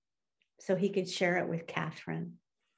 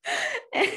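a bright high burst of laughter; 3 dB louder than usual. Real, not acted. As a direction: let out a quick high laugh. Laughs at wife's care.

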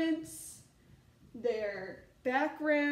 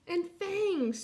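higher-pitched excited cub; mild mock screech; 7 semitones higher than usual. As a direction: pipe it up, a touch alarmed. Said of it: "screeched Chester".

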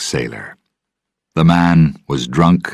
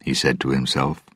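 ordinary delivery

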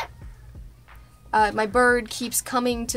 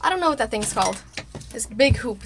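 normal talking voice

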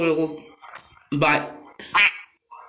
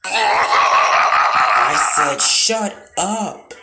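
higher, louder shout of Shut up; shouted order; 4 semitones higher, 5 dB louder, much slower than usual. Pretend. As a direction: bellow it at the pest. Repeats order, now shouting.

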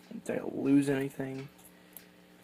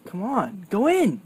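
higher, louder plea rising to a shout; straining yell; 7 semitones higher, 7 dB louder than usual. Real, not acted. Driver yelling at the battery while forcing it. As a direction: shove it in and yell at it.

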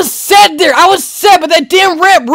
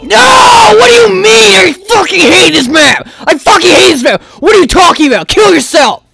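furious rant; 3 semitones higher than usual. Real, not acted. Gamer who hates this map, furious yelling. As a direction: explode in outrage at the game.

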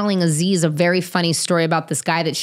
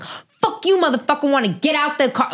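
high, heated mock yell; retold road rage; 9 semitones higher than usual. Retelling cursing a stranger in traffic, high mock rage. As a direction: replay the rage with high pitch. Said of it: "like, crazy".

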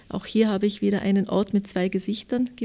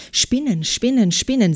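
higher, louder cry with each word falling; narrated shriek; 3 semitones higher, 7 dB louder than usual. A narrator's reading. Someone at the window spots spiders, narrated shriek. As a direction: call out the alarm as a storyteller's shriek. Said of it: "Jemand kreischte".

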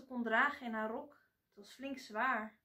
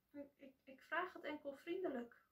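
timid reply; 3 semitones higher, 9 dB quieter than usual. A narrator's reading. Timid girl answers her mother.

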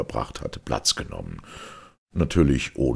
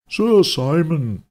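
slow, higher, louder mock-cordial greeting; drawn-out fake welcome for an unwanted guest; sweet false politeness; feigned friendliness; 7 dB louder, slower than usual. A narrator's reading. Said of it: "mit gespielter Freundlichkeit".

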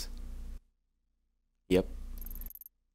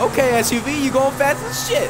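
excited; 13 semitones higher, 4 dB louder than usual. Real, not acted. Impressed by fast SUV.

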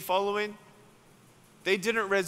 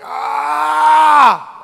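sudden high pained cry, much louder; pained scream; 10 semitones higher, 16 dB louder than usual. Retold or imitated. Preacher imitating a paralyzed woman's scream of pain. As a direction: mimic the woman's cry of pain as she tries to stand. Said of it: "her face was like".

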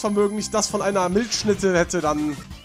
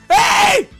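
high loud yell; shouted hey; 9 dB louder than usual. Real, not acted. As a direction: yell in alarm. Game reaction. (lauter Ruf)

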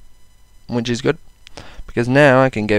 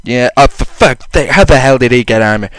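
ordinary delivery

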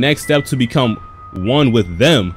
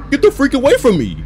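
scared horror game streamer; 10 semitones higher than usual. Real, not acted. Demon on TV.

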